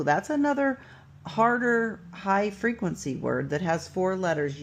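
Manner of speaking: usual speaking voice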